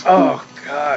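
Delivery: exasperated dad; dad's groan. Retold or imitated. Father reacts to his son's shout, pained. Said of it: "And he goes".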